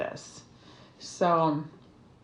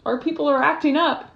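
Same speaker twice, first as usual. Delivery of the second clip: mock fans calling; 8 semitones higher, 7 dB louder than usual. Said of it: "you guys start messaging me going".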